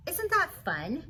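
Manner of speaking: ordinary delivery, nothing marked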